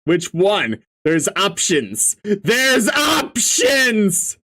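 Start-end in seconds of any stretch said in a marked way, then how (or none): 2.2-4.4 panicked cry. Acted. Panicked reply to ominous advice.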